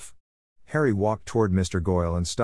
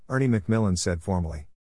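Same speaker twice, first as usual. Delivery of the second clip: ordinary delivery, nothing marked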